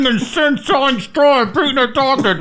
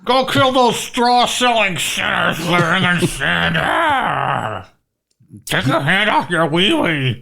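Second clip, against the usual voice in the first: low ghostly mother voice; haunting voice; 6 semitones lower than usual. Pretend. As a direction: growl like a scolding ghost mother. Voicing mother's ghost again.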